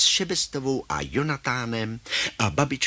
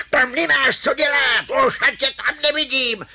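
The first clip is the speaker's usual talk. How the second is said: high, loud scolding shout; hollering; 13 semitones higher, 5 dB louder than usual. Acted. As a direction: holler the dog out of there. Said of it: "huláká". (rozzlobené hulákání)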